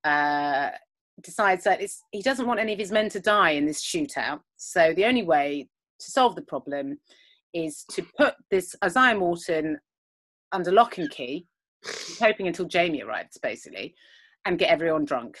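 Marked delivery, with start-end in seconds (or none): none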